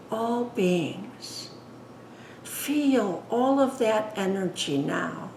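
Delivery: unhurried, hushed guidance, phrase by phrase; guided meditation. Acted. Leads guided meditation after plain introduction.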